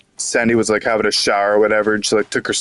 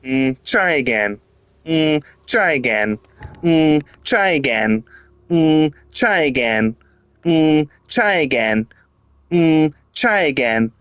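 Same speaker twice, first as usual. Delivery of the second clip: higher, slow, sing-song mock cheer; SpongeBob voice; 6 semitones higher, slower than usual. Gamer mocking the game as SpongeBob, high slow sing-song. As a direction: chirp it like a chipper cartoon sponge. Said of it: "It's like that SpongeBob episode".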